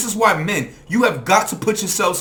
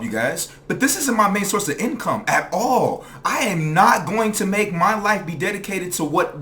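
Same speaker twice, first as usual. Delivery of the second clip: ordinary delivery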